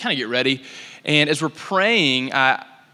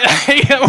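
higher, louder burst of laughter; 11 semitones higher, 8 dB louder than usual. Real, not acted. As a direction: laugh out in disbelief. Laughs at the healing story.

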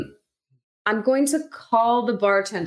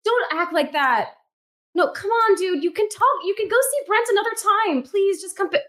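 acted desperate pleading; 10 semitones higher than usual. Character begging a friend to come pick her up, desperately pleading. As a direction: beg higher and higher into the phone.

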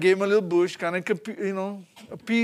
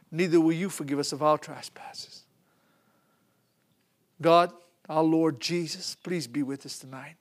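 closing prayer; 5 semitones lower than usual. Acted.

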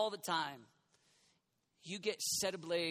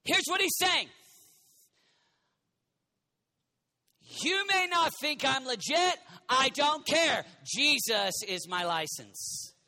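loud, higher full-force preaching; 9 semitones higher, 8 dB louder than usual. Acted. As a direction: retell Paul with fire. Preaching Paul's story.

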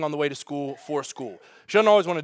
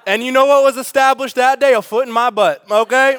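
loud punchline; 7 semitones higher, 9 dB louder than usual. Real, not acted.

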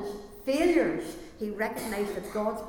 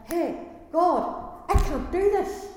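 higher, louder cry of someone at the end of themselves; desperate cry; 9 semitones higher, 8 dB louder, much slower than usual. Acted. Believer at the end of their rope cries to God, desperate. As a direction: cry out to God like you can't go on. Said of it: "all of a sudden it's like".

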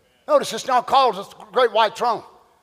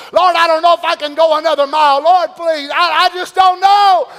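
desperate prayer; 6 semitones higher, 7 dB louder than usual. Retold or imitated. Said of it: "How many's ever said it?".

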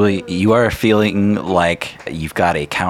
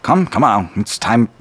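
impatient father; 5 semitones higher, 3 dB louder than usual. Acted. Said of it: "You hear".

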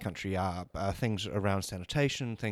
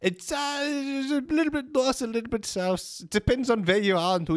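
evasive high voice; 12 semitones higher than usual. Acted. Evasive disguised candidate answer.

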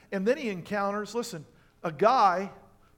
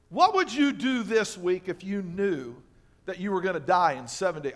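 ordinary talk

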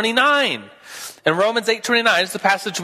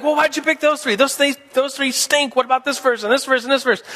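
higher, fast, indignant debater voice; mock raging Arminianist; 7 semitones higher than usual. Teacher mimicking an Arminian scoffing at the picks, higher and fast. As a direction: huff it out like a riled-up arguer. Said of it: "raging Arminianist, be like".

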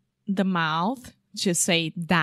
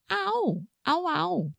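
faking pain; 10 semitones higher, 4 dB quieter than usual. Shown as a demonstration. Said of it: "You have this sound in Portuguese".

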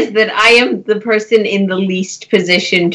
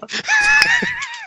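shrieking laugh; 5 dB quieter than usual. Real, not acted. Player bursts out laughing.

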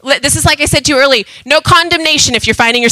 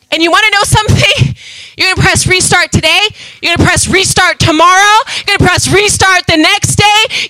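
higher, ringing pulpit proclamation; fiery preaching; 6 semitones higher than usual. Acted. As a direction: preach it out with full conviction. Preaching at full force.

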